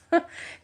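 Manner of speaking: a quick amused giggle; short high laugh. Real, not acted. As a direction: let a little laugh slip. Laughs at husband's comeback.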